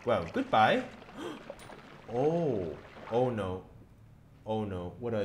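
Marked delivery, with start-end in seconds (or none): 0.9-2.1 breathy startled gasp; sharp gasp. Real, not acted. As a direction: gasp in sudden fright. Sudden scare.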